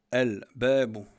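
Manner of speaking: very loud "Al-Babu" with a clear L; lam pronounced. Shown as a demonstration. Teacher giving the ba example, loud with L sounded. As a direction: belt out "Al-Babu", L sounded before the B. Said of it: "on prononce le lem".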